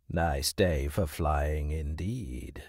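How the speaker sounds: low, slow, stern hawk; deep unyielding voice. Acted. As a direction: speak down like a proud hawk. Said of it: "His voice was deep and unyielding".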